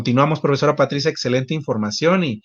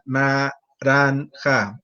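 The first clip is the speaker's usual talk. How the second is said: "naranja" chopped into slow robotic syllables, na-ran-ja; much slower than usual. Pretend. Children playing robots, speaking "naranja" mechanically and slowly. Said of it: "vamos a imaginarnos que somos robots".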